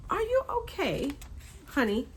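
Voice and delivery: high, sing-song fake concern; mock sweet concern. Vlogger mocking how the mom babies her son, in a high cooing voice. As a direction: coo it like talking to a small child. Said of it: "And I'm just like".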